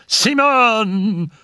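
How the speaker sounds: high, long-held call; anguished cry. A narrator's reading. Raoul calls again to the medium, desperate cry. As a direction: call the name desperately, pitch soaring. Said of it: "cried Raoul".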